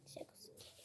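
breathy whispered repeat; whispering. Shown as a demonstration. Repeats phrase, then whispers it.

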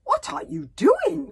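high, loud, slow terrified cry; in terror. A narrator's reading. Hiccup waking to swords above him, terrified. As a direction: wake to a sword overhead and cry out. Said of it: "asked Hiccup in terror".

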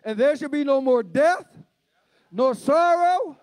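high-pitched, ringing scripture proclamation; fervent preaching. Real, not acted. Preacher reads Revelation's promise to church, fervent preaching.

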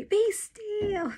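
very high-pitched urgent call; yelled command. A narrator's reading. Brother to child swarmed by bees, yelling. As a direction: cry out the order high and urgent. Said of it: "Ray just keeps yelling".

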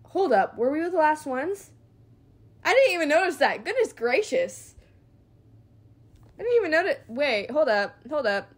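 loud, high, fast alarm. Real, not acted. Player suddenly realising she was near the last enemies. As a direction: blurt out in shock.

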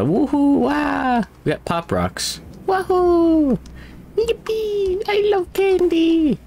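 put-on high-pitched excited kid squeal; wahoo yell. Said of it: "Yeah, yeah, woo, stop somewhere".

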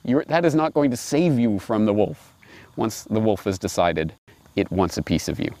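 ordinary talk, nothing marked

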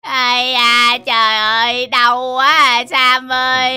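low, drawn-out groaning; acted pain. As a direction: moan in hammed-up agony to a friend. Hero groans after being beaten.

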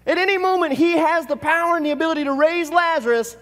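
rising fervour. Acted. Preacher stirring the congregation about raising Lazarus, fervent and loud. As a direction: lift them toward the miracle.

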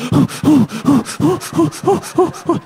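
hammed-up breathless huffing; comic rapid gasping; mock rapid panting gasps. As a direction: pant in quick bursts like a woman in labour. Retold panting.

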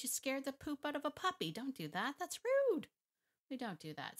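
high-pitched indignant telling-off; mock scolding. Trainer telling off a dog rushing a puppy, indignant. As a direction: scold it, jumping up high on the last word.

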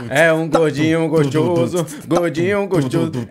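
slow, low cooing over a chubby pet; slow affectionate cooing. Real, not acted. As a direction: coo lovingly at the chubby one.

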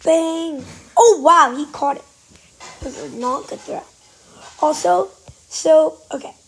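ordinary talk, nothing marked

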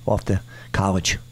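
ordinary delivery

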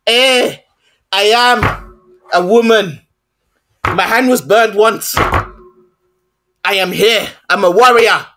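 slow, drawn-out mock African accent; Lashana Lynch's film accent. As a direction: imitate a film warrior's African accent, very slowly. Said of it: "Let me do the accent that she did".

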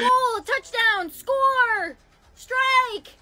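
high, drawn-out celebratory calls; sports cheers. Acted. Acting out clues for babe, high cheering calls. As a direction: cheer each word as its own big call.

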